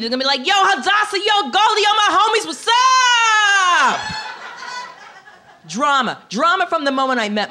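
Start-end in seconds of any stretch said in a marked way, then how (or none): 0.5-4.0 high, loud hollered hello to homies; mock street greeting. Kids in Harlem greeting their cousins, hollered street greeting. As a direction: holler to your homies, drawing out the last word.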